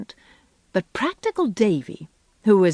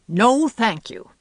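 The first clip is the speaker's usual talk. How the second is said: slow, higher, indignant refusal; indignant emphasis; 5 semitones higher, 4 dB louder, slower than usual. A narrator's reading. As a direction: refuse firmly, drawing out each word. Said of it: "with indignant emphasis".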